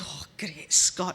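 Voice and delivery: whispered groan of dismay; husband muttering as he gives in. Acted. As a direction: breathe it out in defeat. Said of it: "So Andy goes".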